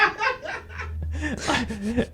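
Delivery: delighted high-pitched laughter; real giggle fit; high squealing laugh. Real, not acted. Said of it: "I just love that".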